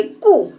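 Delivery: कु in one brief burst; quick short vowel. Shown as a demonstration. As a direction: keep कु very short. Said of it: "जल्दी से बोल देंगे". (झट से बोला गया छोटा कु)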